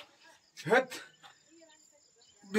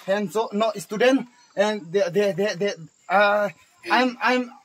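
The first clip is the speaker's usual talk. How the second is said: vlog presenter; 8 dB louder than usual. Acted. Teacher turns to the camera to introduce his class, announcing loudly. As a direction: present it to your viewers.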